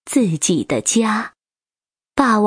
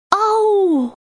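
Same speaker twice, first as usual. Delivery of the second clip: the T. rex roars to save his babies; terrifying roar; 12 semitones higher than usual. A narrator's reading. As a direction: let out a fearsome dinosaur roar. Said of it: "霸王龙发出了恐怖的咆哮".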